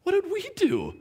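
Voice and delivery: high-pitched, disbelieving plea; imagine innocent men protesting a death sentence; bewildered captives. Acted. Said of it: "I can imagine Daniel and Shadrach".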